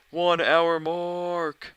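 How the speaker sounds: loud, high, drawn-out call; announcing a milestone. Real, not acted. Streamer calls out the hour mark to viewers, loud announcement. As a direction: call it out big, stretching the words.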